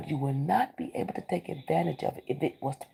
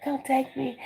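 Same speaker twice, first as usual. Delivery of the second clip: voicing the opportunity; 9 semitones higher than usual. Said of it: "something's in your face".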